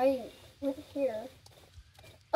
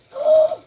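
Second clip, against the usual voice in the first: loud delighted gasp; 16 dB louder than usual. Real, not acted. Sees the slow gallop.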